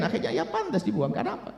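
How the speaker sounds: ordinary talk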